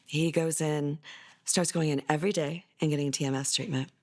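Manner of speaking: usual speaking voice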